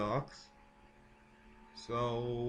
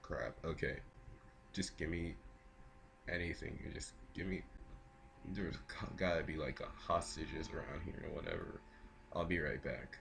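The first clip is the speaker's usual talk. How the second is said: much softer, lower muttering; quiet muttering; 5 semitones lower, 12 dB quieter than usual. Pretend. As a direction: mutter it under your breath. Villain muttering to himself.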